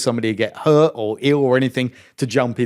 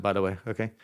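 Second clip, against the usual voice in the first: normal talking voice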